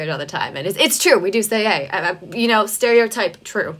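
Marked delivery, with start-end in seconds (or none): none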